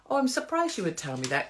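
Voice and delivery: high-pitched, pointed surprise; demonstrating a wounded comeback; mock surprise. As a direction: act politely taken aback, pitched high. Models the same reply once more.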